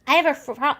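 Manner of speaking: hoarse, throat-clearing voice; frog in throat. Real, not acted. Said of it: "a frog in my throat".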